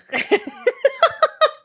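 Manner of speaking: high squealing laugh. Real, not acted. Laughs at a chat message.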